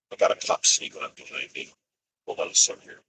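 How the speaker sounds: hushed words while a cop tails him; real whisper. Real, not acted. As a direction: whisper it so the cop won't notice. A cop is behind him while driving.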